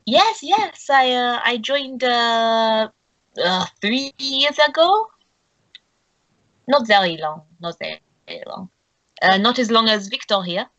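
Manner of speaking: put-on French accent, starting high then settling; put-on French accent with pauses. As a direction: answer hesitantly in a French accent. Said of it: "his French accent".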